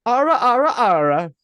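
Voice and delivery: high, loud "Ara, ara, ara" in a mock Italian accent; a hammed-up Italian accent, shouted high. Shown as a demonstration. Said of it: "You have to do it".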